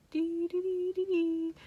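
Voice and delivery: high, soft doll singsong; a little doll's eerie chant; high eerie doll ditty; creepy doll voice. Retold or imitated. Imitating creepy doll sound.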